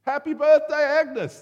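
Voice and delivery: loud, high celebratory shout; group shout. Retold or imitated. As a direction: yell it out like a surprise party crowd. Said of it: "They're all kind of shouting".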